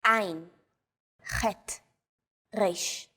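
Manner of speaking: Ein, chet, resh with Hebrew guttural sounds; deep in the throat. Shown as a demonstration. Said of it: "pronounced deep in the throat".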